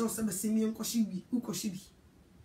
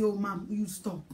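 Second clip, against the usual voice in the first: put-on low, soft, dragged-out teen brush-off; sulky teen brushing off mom; mock teen whine; 5 semitones lower, 5 dB quieter than usual. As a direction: grumble it like a sulky kid. Voiced child dismissing mother.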